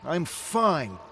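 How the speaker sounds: loud, high retort; defiant insistence. Acted. Drunk David insists to Rick as he leaves, loud and defiant. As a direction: cut the talk off.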